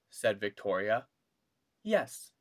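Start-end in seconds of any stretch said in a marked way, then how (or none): 1.8-2.3 bright, much higher teacher. A narrator's reading. Miss Twinkle answering Victoria, much higher. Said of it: "said Miss".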